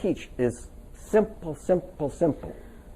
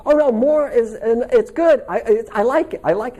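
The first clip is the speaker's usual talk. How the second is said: gushing friend; 7 semitones higher, 4 dB louder than usual. Retold or imitated. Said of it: "She said".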